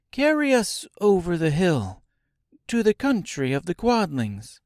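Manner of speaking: put-on high-pitched girl giving an order; Dorothy's voice. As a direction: command in a high girl's voice. Girl Dorothy gives a command.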